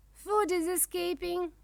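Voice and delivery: high-pitched dragon cry; alarmed cry. A narrator's reading. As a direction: cry out as dinner gets away. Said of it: "cries Dragon".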